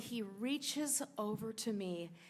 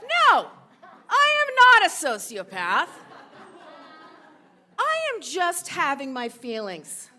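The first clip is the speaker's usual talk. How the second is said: high, booming shout, easing slightly by the end; emphatic self-affirmation; 6 semitones higher, 15 dB louder than usual. Retold or imitated. Son telling himself he is no sociopath, in a loud hammed-up declaration. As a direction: proclaim it for comic effect at full volume.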